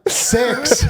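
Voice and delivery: high, laughing punch; comic over-emphatic repeat; emphatic mock declaration. Jokingly repeats it one more time.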